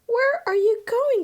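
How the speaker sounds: high, loud startled call; crying out. A narrator's reading. Ming calling after the escaping wok, loud startled cry. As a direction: shout after the wok as it rolls off. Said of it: "cried Ming".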